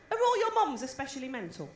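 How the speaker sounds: ordinary delivery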